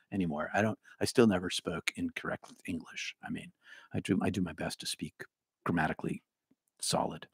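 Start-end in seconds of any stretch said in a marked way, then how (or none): none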